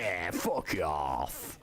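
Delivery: slow mock British shout; drawn-out British jeer. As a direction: stretch it out like a British lad. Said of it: "I'm fucking talking like him".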